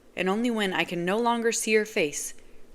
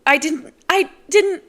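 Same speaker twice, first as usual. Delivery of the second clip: much higher, loud pleading woman; frantic denial; 11 semitones higher, 12 dB louder than usual. Acted. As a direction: protest desperately. Nazira protesting.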